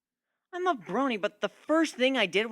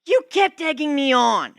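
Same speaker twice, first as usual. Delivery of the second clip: high, loud and slow protest; defensive shout; 12 semitones higher, 13 dB louder than usual. Acted. Pegasus defending herself in the shop, shouting high and slow. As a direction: yell it to justify herself. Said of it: "she defended herself".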